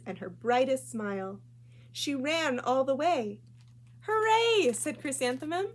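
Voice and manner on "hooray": high, loud joyful hooray; excited cheer. Acted. Little girl cheering on her way to school, high and loud. Child running to first day.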